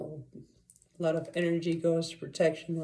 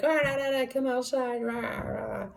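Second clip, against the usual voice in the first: demon gibberish; 7 semitones higher than usual. Retold or imitated. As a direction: mimic the creature's noises down the phone. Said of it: "And this thing's like".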